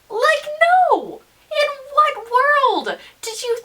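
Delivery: exasperated, can't believe it; high incredulous outburst; outraged disbelief. Real, not acted. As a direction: pitch leaps high on like, then a firm no. Outraged at the guy.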